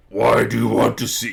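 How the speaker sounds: big put-on booming demand. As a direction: boom it like a looming giant. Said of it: "here, the booming voice".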